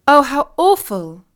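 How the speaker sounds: loud, high dismayed cry; shocked exclaim. Acted. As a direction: react with shock. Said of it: "exclaimed Katy".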